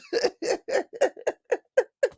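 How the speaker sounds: high, breaking giggles; real fit of laughter; long helpless laugh. Real, not acted. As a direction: laugh until out of air.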